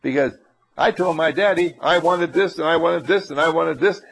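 ordinary talk, nothing marked

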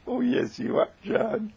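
high, sweet teasing falsetto; girlish lovey-dovey insistence; cooing Marion. A narrator's reading. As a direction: coo it back at him. Marion teases John sweetly.

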